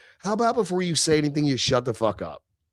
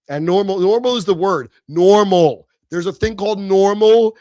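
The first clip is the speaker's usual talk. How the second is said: louder, hammering the word "normal" each time; emphatic shout; 5 semitones higher, 8 dB louder than usual. Real, not acted. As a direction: bark "normal" like a slogan you won't let go of. Ranting about what is normal.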